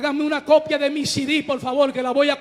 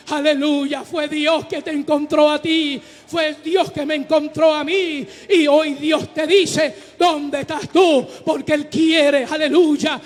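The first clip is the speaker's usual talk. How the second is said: fiery preaching; 5 dB louder than usual. Acted. Pastor to congregation about forgiveness, fiery loud preaching. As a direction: preach it out full force to the church.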